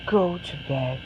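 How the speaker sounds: mother ending the questions; finally firm mother. Acted. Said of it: "who finally says".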